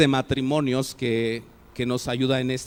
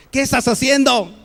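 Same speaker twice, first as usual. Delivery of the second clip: higher and louder, a scolding ¿Qué estás haciendo?; sharp angry demand; 8 semitones higher, 5 dB louder than usual. Shown as a demonstration. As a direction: snap ¿Qué estás haciendo? like catching someone out. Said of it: "lo puedo decir de diferente forma".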